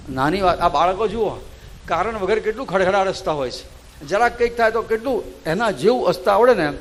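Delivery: loud, fervent sermon voice; preaching at full force. Acted. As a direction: rouse the congregation with conviction. Preacher builds to a rousing sermon.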